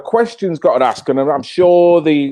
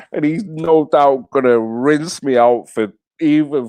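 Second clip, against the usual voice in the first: real laugh running into the words. Real, not acted. Streamer expecting a teasing from a Brummie friend, laughing. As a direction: talk through your laughter.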